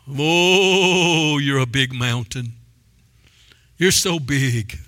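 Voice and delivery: low, slow awed murmur at the mountain; mocking a believer cowed by the mountain, low and slow; mock awe. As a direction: gape at how big it is, slowly. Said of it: "what are you doing when you're talking to it?".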